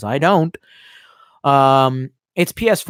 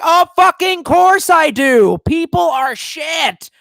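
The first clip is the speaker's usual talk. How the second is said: much higher, louder, slow furious yell; retold shout; 12 semitones higher, 7 dB louder, much slower than usual. Shouting back at mom over the dog, furious yell. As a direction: bellow it out, each word dragged.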